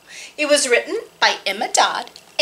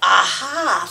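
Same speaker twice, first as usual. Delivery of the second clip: sly cat; 6 semitones lower than usual. Acted. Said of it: "thought the cat".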